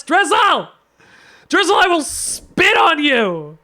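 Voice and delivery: loud, high, drawn-out outburst; mock-outraged yell. Streamer threatening Drizzle, mock-outraged yell. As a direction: holler it as an over-the-top threat.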